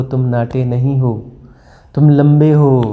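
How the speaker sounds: ordinary talk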